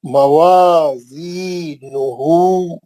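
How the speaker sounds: slow, drawn-out Quranic recitation. Shown as a demonstration. Teacher recites word slowly for class. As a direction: chant each syllable slowly for students. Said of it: "जहां जहां मध्य असली है".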